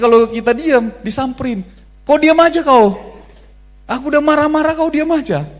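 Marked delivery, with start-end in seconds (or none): 2.0-5.5 high, loud nagging wife; hammed-up scolding wife, shrill with pitch up. Wife scolds silent husband. (istri memarahi suami dengan suara tinggi dan keras)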